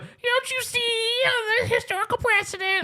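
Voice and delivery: very high, strained mock plea; Chris Messina impression. Chris Messina arguing with Andrew Scott, high mock wailing. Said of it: "And Chris Messina's like".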